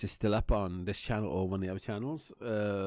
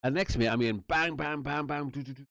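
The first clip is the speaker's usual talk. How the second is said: ordinary talk, nothing marked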